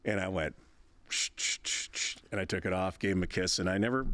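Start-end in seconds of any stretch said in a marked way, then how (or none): 1.1-2.2 soft unvoiced shushing; a quiet hushing whisper. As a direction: hush him gently, just breath. Father hushing son while shaving.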